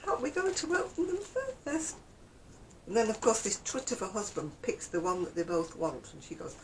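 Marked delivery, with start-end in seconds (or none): 0.1-2.0 high-pitched mock politeness; mimicking advert woman. Mocking the gift-giver who wants chocolates, mimicking voice. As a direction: put on a higher, wheedling guest voice. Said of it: "You don't say".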